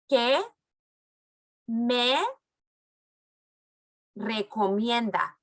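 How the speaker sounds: each word alone with pauses to repeat; slow word by word. Shown as a demonstration. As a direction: stretch out recomienda slowly. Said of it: "When I go like this, that means it's your turn".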